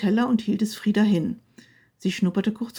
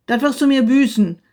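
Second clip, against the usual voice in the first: loud, higher threat; threatening shout; 3 semitones higher, 8 dB louder than usual. A narrator's reading. Husband bellows threat at fleeing wife.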